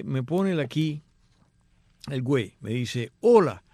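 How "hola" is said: loud greeting. Retold or imitated. Reading listener's email greeting aloud.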